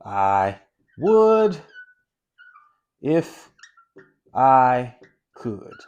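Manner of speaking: I would if I could, each word set apart by pauses and would lifted; slow word by word. Shown as a demonstration. Coach voicing the hidden excuse, slow with would lifted. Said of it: "you're simultaneously saying".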